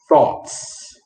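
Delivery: "thoughts" with a crisp t and audible s at the end; final consonants pronounced. Shown as a demonstration. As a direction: let the final "ts" of "thoughts" hiss clearly. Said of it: "Do you hear the s".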